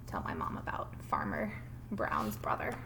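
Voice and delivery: low hushed whisper. Shown as a demonstration. Teacher whispering a reminder to herself while reading. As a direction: breathe it out to yourself.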